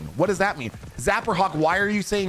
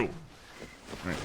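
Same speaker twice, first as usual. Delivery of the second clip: whisper; 11 semitones lower, 16 dB quieter than usual. Real, not acted. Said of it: "whispered after taunt".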